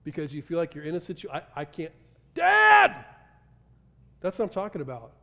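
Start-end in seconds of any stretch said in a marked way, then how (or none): 2.3-3.0 high, loud cry for dad; frightened child's shout for dad; child calling out. Retold or imitated. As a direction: call out for dad from a dark room. Child crying out in dark.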